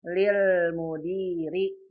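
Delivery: lil mudiri read slowly, lil with doubled l; slow clear reading. Shown as a demonstration. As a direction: spell out lil mudiri syllable by syllable. (lil mudiri dibaca pelan dengan lam ganda)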